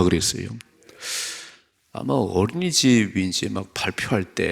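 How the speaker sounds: ordinary talk, nothing marked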